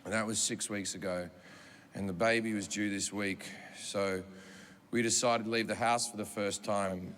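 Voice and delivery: low, halting, apologetic voice; hushed, heavy-hearted apology; subdued grieving husband. Retold or imitated.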